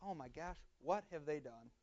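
hushed, low dismay; pitying disbelief. Acted. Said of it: "he doesn't get angry".

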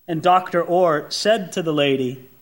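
usual speaking voice

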